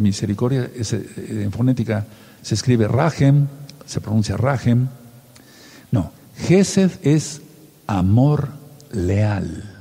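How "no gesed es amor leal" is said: slow, weighty definition. Shown as a demonstration. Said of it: "Voy a volver a repetir".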